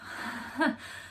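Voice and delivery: one short, uneasy breathy laugh; a quick nervous laugh, almost under the breath; a single soft, unvoiced laugh. A narrator's reading. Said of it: "He uttered a short laugh".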